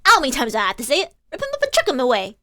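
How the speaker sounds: put-on fast, impatient Cockney girl; snappy street urchin. Gina snaps at the lawyer to tear up the papers, fast and fed up. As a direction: rattle it off, fed up.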